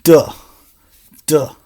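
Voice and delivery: short voiced duh sound. Shown as a demonstration. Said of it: "The D makes the sound".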